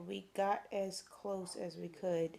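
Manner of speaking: slow, word-by-word dictation; dictating. Shown as a demonstration. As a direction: read it out slowly so it can be written. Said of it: "You need to put an indent at".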